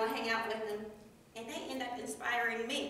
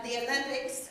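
ordinary talk

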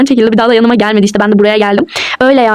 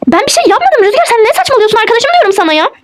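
exasperated protest; 9 semitones higher than usual. Acted. Heated quarrel with Rüzgar.

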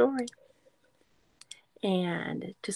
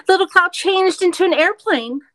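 excited; 9 semitones higher, 9 dB louder than usual. Acted. Excited page reveal.

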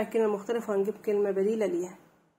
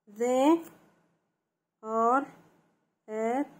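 each word alone, lifted at the end; word-by-word dictation; much slower than usual. Shown as a demonstration. Teacher dictating sentences to children, word-by-word dictation. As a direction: dictate slowly with long gaps.

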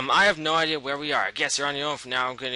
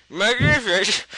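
said through a mouthful; chewing words, mouth full; 6 semitones higher, 5 dB louder, slower than usual. Real, not acted. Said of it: "[name] seems to enjoy talking with his mouth full".

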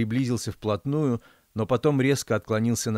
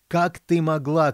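slow furious yell, high and loud; queen yelling while chasing her daughter; angry shout; 6 semitones higher, 5 dB louder, slower than usual. A narrator's reading. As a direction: scream it at your daughter. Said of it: "кричала королева".

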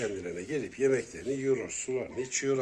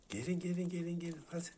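high cooing call to the kittens; cooing pet call; 6 semitones higher, 4 dB quieter than usual. Acted. Owner luring the kittens toward her, in a high coo. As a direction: lure them out in a high, soft coo.

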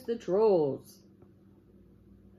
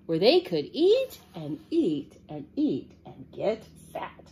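animated storybook narration with swooping emphasis; storytelling read-aloud; 3 semitones higher, 4 dB louder than usual. Acted. Librarian reads the three billy goats tale to children, animated storytelling. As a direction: read it big and lively for children.

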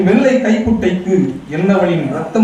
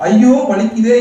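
higher, louder pained whimper; 6 semitones higher, 4 dB louder than usual. A narrator's reading. Girlfriend whimpers to her lover about her sore foot. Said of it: "என சினுங்கினாள்".

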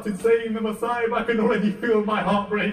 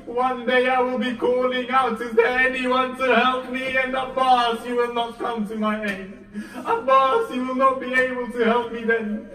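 louder, higher lamenting outcry; anguished cry; 3 semitones higher, 3 dB louder than usual. Acted. Imam Hussein crying to young Abbas about the future, anguished lament. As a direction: let his grief break out as he calls to Abbas.

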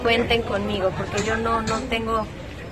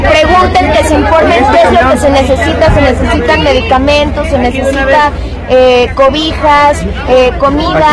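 much louder voice, projecting over the crowd; loud over the noise; 8 dB louder than usual. Real, not acted. Announcing the aid truck to a noisy crowd, loud and projected.

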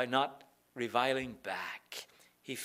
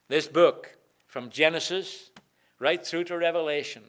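much louder, held up proudly; emphatic proclaiming; 7 dB louder than usual. Acted. Holding up the Bible.

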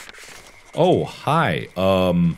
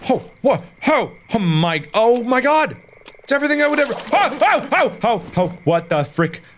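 real terrified screaming. Real, not acted. Gamer screaming in terror as a creature attacks. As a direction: scream for your gun as it lunges.

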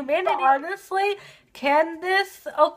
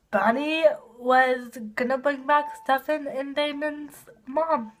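ordinary delivery

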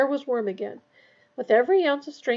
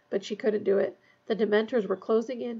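ordinary delivery, nothing marked

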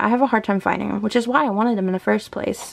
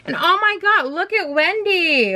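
much higher, slowed, delighted disbelief at the drawing; amused outburst; 9 semitones higher than usual. Real, not acted. Showing viewers a funny Wendy illustration, high amused outburst. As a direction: cry it out as if you can't believe the picture. Laughing at silly book illustration.

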